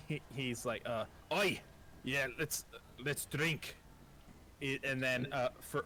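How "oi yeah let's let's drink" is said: Scottish dwarf. Acted. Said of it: "my Scottish accent".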